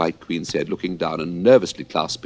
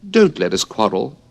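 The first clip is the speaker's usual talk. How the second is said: anxious tone; 5 semitones higher, 6 dB louder than usual. Acted. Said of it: "in an anxious tone".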